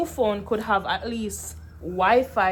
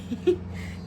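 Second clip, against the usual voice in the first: giggle; 9 semitones higher than usual. Real, not acted. Laugh about the packages.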